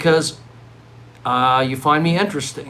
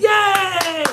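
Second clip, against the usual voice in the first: much higher squeaky mock cheer. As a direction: cheer like the crowd loves chili. Crowd cheering for chili.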